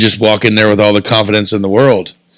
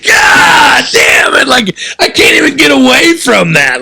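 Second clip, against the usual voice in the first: exasperated shout. Retold or imitated. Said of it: "And I was like".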